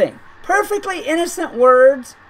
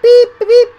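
bleep imitation; 11 semitones higher, 8 dB louder than usual. Shown as a demonstration. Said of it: "starting to sound like it's Morse code".

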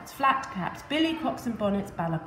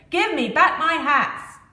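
loud, slow, higher shout; 7 semitones higher, 8 dB louder than usual. Acted. Hat seller shouting up at monkeys, loud slow shout. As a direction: call it up into the trees. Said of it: "shouted up at them".

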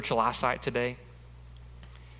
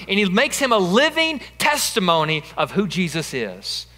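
louder, higher preacher's build, pressing the point home; preaching at full force; 6 semitones higher, 6 dB louder than usual. Acted. Preacher urging the church to ask what now, full force. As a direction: drive it home to the congregation.

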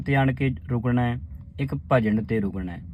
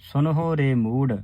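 ਹਰ ਹਰੇ ਹਰੇ ਭਾਜ said slowly with rests; slow recitation. Shown as a demonstration. Teacher showing the sangat how to say the line. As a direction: chant slowly, pausing at ਭਾਜ and Nanak. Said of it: "ਇਸ ਦਾ ਉਚਾਰਨ ਹੈ".

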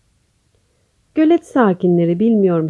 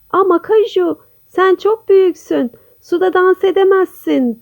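high-pitched little bird voices; teasing birds; 7 semitones higher than usual. A narrator's reading. Birds tell Kaju it is too big, high-pitched. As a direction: object in a raised storybook character voice. Giggling birds object to hippo.

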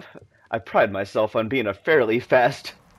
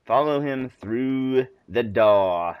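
put-on slow, stretched-out British accent; slower than usual. Player reading a prompt in a British house, put-on British accent. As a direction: draw out each word in put-on British, no r in "door". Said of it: "It feels like a very British".

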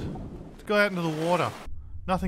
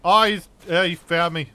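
alarmed high yelps as a crab spots him; startled yelps; 5 semitones higher, 8 dB louder than usual. Real, not acted. Yelps of alarm, pitch jumping up.

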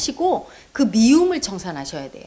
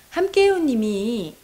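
normal talking voice

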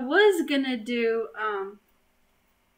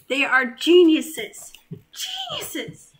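mock awe; 3 semitones higher, 6 dB louder, slower than usual. Mock praise of kids' drawing.

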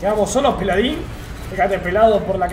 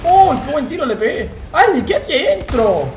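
panicking gamer; 8 semitones higher, 3 dB louder than usual. Real, not acted. Pánico en la pelea.

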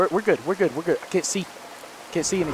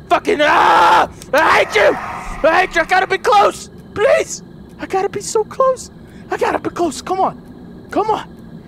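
frantic yelling; 5 semitones higher than usual. Real, not acted. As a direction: yell in pure frustration and fear. Monster attacking near the end.

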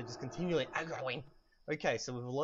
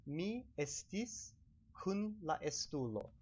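slow, careful Esperanto model sentence; drawn-out first word; 4 semitones higher, 4 dB quieter, slower than usual. Shown as a demonstration. Teaching viewers a past tense sentence, slow and careful. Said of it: "how would we say".

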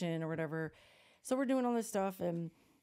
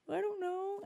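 very high mimicked toddler voice; guilty little kid; slower than usual. Toddler questioned by her mom, high guilty mimicry. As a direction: squeak it out like a cornered child.

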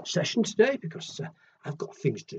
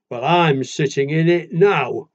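louder, slow and stubborn; big animal voice; 8 dB louder, slower than usual. Acted. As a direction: plant yourself like the big one. Big animal answers the little one.

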